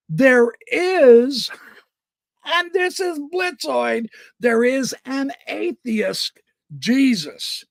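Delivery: high, slow showman announcement; dramatic reveal. Real, not acted.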